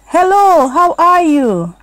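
lightly voiced old man, high and slow; a hint of a cheerful old neighbour; old man greeting. Old man greets Peanut from his steps, lightly voiced.

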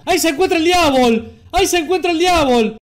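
high and loud mock panic; over-the-top mock terror; hammed-up alarm. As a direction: yell it like a cheesy horror trailer. Mock horror announcement.

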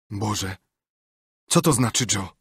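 a touch of stunned fear; lightly voiced, forcing the words out; choked out. A narrator's reading. Potter waking beside the body, mildly choked fear. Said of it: "wykrztusił".

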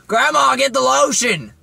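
high, loud mock holler; calling for Grandma. Grandson pretending to call Grandma for lotion, comic high holler. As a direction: yell it through the house.

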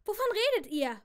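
high, loud bewildered question; confused question. A narrator's reading. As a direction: ask in sudden confusion. Sophie asks, confused.

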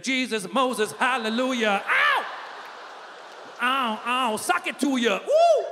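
hammed-up rapper with high whoops. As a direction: parody a rapper's hype, building to squealed ad-libs.

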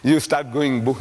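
ordinary talk, nothing marked

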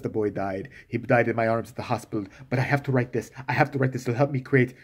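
low and deadpan, only caring about his work; mock self-absorbed husband. Husband insists he must write, low and deadpan.

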